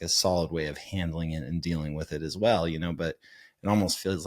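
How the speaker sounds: ordinary talk, nothing marked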